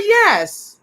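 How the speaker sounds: high, drawn-out yes falling at the end; emphatic affirmation. Retold or imitated. Boss reassuring doubtful staff member, emphatic affirmation. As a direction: insist with a big pitch swoop. Insists area has produced winners.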